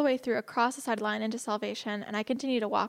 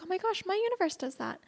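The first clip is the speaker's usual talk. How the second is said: higher-pitched, imitating a delighted friend; mild amazement; 8 semitones higher than usual. Friend marvels that her universe does that, mildly amazed. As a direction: lift the pitch in mock wonder.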